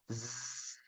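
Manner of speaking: "Z" as a long, soft voiced buzz; vibrating Z. Shown as a demonstration. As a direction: sustain "Z" with the throat vibrating.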